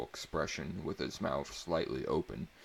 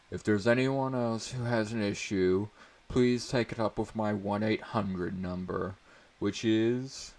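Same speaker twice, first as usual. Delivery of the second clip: louder, higher playful teacher; 4 semitones higher, 5 dB louder than usual. A narrator's reading. Teacher to class, louder playful setup. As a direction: call out to the class, joking.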